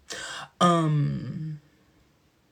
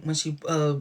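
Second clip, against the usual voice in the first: ordinary delivery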